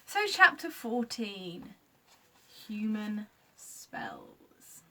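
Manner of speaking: slow, drawn-out title, ending in a whisper; chapter title. A narrator's reading. Reading the chapter title aloud to the young listeners, slow and hushed. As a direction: announce it, then breathe the title. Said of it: "reading chapter title".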